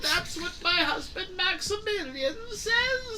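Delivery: high, loud doddering granny; a cheeky old crone selling her husband; cackling old lady. Acted. GM holds old woman character.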